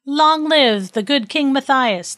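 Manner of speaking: high, drawn-out storybook cheer; cheering shout. Acted. Townspeople hail the king riding in, mock cheering shout. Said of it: "they shouted over and over again".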